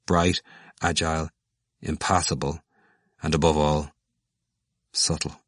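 understated bitterness, word by word with pauses; somewhat bitter. A narrator's reading. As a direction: list them one at a time, a touch bitterly. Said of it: "Stephen said somewhat bitterly".

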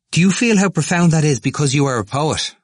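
high, loud eager question. A narrator's reading. Temple touching the poet's elbow, eager. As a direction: press him eagerly for agreement. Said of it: "and said eagerly".

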